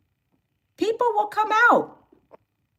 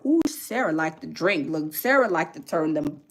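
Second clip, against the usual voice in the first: scheming [name]; 13 semitones lower, 6 dB quieter than usual. Retold or imitated.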